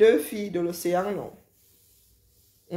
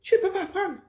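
higher insistent repeat; high emphatic echo; 11 semitones higher, faster than usual. Real, not acted. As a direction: say it again much higher for emphasis. Emphatic repeat of own line.

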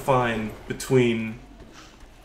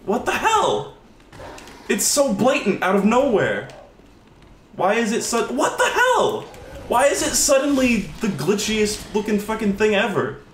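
high-pitched, baffled protest; real exasperated bewilderment, high; incredulous outburst; 8 semitones higher than usual. Real, not acted. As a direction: exclaim in disbelief, voice jumping up. Incredulous at glitching boss.

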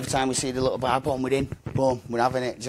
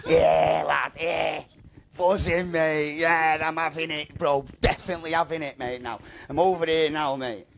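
higher, buzzing re-enactment of arriving; retold excitement; 4 semitones higher than usual. Lad telling interviewer about landing in Manchester, retold excitement. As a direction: relive the thrill, pitch up, opening on a shout.

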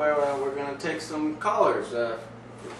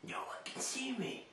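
high whisper; 12 semitones higher, 15 dB quieter than usual. Acted. Hiding under blanket pretending.

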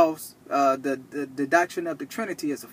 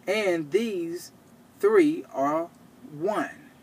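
drawn-out verse with long pauses between phrases; very slow; 2 semitones higher, much slower than usual. Real, not acted. Preacher spells out the Trinity for slow listeners, very slow. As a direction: spell it out slowly, counting after each name. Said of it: "I have to say that slow".

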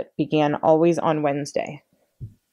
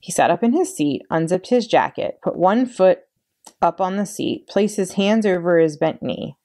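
plain reading voice

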